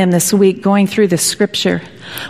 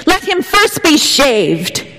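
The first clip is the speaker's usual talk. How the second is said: higher, slow, cruel witch voice; 7 semitones higher, slower than usual. Retold or imitated. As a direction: savour the order as the witch. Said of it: "says the witch".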